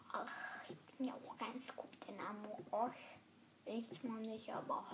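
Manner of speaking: fast ironic whisper; sly whispered aside. Real, not acted. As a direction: whisper it quickly. Self-deprecating whisper aside.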